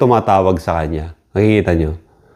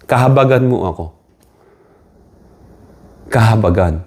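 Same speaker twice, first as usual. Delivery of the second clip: normal talking voice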